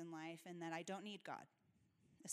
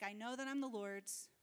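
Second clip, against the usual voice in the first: ordinary delivery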